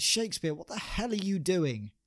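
high, startled outcry; shocked exclamation. Acted. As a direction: burst in high and alarmed. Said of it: "Ben Jonson bursts into the kitchen".

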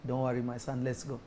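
soft, low fatherly voice; gentle reassuring. Pretend. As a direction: hush it down, kindly. Jesus answering softly.

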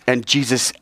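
ordinary delivery

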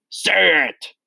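high, abrupt bellowed order; bellowing. A narrator's reading. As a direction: bellow it as a command. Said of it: "bellowed the Trunchbull".

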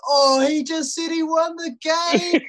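loud, high, slow teasing call; mocking teammate. Loudmouth teammate telling the changing room, high, slow and teasing. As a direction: dob him in with a high, slow, gleeful mock. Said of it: "big mouth, gets up and goes".

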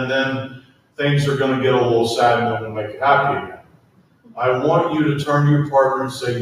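ordinary talk, nothing marked

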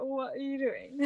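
high, soft incredulous question to yourself; lightly acted exasperation, laughter close behind; mock disbelief. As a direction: chide your past self in a small high voice. Acted exasperation at past self.

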